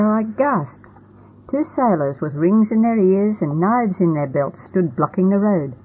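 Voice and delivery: ordinary delivery, nothing marked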